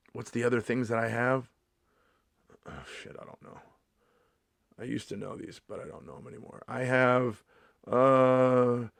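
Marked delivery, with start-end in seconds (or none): 2.7-6.6 soft low mutter; embarrassed muttering to himself; mutter to self. Real, not acted. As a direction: mumble it to yourself.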